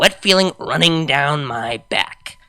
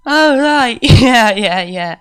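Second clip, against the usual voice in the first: put-on drunk laughing; 8 semitones higher, 7 dB louder, slower than usual.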